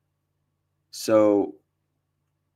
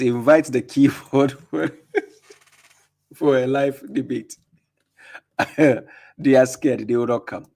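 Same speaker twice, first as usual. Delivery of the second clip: reading the comment while cracking up; real laughter spilling into speech; laughing; 3 semitones higher, 3 dB louder than usual. Real, not acted. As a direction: chuckle through every line, a bit louder. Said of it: "get me laughing".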